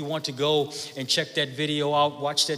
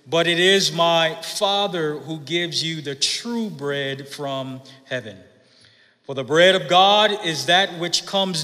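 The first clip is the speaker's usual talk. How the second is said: measured, solemn reading of the Gospel; scripture reading; 4 dB louder than usual. Acted. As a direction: read the passage out to the congregation. Reads the Gospel passage aloud.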